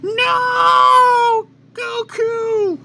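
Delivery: anguished screaming cry, very high and loud. Acted. Chi-Chi screaming on hearing Goku died. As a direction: scream his name in grief. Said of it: "Chi-Chi screams".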